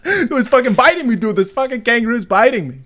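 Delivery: put-on comic accent slipping toward Arnold Schwarzenegger; muddled mock accent. Student bitten by the kangaroo, panicked mock accent. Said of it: "That was like Arnold Schwarzenegger".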